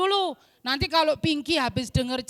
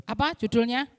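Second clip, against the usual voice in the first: normal talking voice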